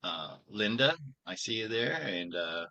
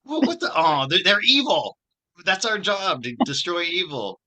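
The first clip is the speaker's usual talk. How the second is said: loud, high-pitched retold exasperation; mock indignant protest; 10 semitones higher, 11 dB louder than usual. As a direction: protest loudly in hammed-up disbelief. Retelling his protest to a player.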